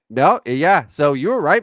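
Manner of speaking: ordinary delivery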